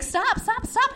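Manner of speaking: high, rapid pleading stops; retold protest. Telling him to stop blowing smoke, pleading. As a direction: bat it away in a high voice.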